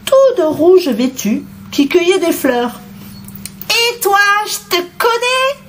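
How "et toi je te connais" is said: put-on very high little-girl voice; little girl's cry. A narrator's reading. Little girl recognising the wolf, crying out. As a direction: call out in a high child's pitch. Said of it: "cria la petite fille".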